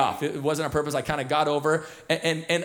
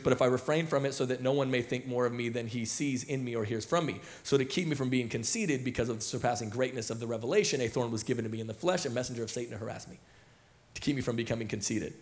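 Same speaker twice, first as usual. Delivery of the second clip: lower, softer read-aloud, picking up speed; scripture reading; 4 semitones lower, 8 dB quieter than usual. A narrator's reading. Pastor reading Paul's words to the congregation, low, soft scripture reading. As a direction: read the verse quietly, running through the phrases.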